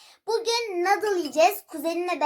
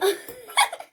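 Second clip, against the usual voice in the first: high burst of laughter; laughing; 8 semitones higher than usual. Real, not acted. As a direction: break into a high giggle. Caught on camera, laughing.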